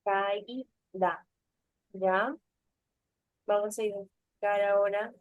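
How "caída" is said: Caída broken into ca, í, da; split syllables. Shown as a demonstration. Teacher repeats the pupil's answer, Caída in separated syllables. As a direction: say Caída syllable by syllable with small gaps. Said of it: "esto se separaba".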